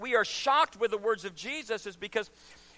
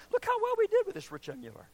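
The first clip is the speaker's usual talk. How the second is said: higher, pleased self-congratulation; imagined gleeful aside; 10 semitones higher, 3 dB quieter than usual. Acted. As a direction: crow over a job well done. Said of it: "you and the Father ... have talked".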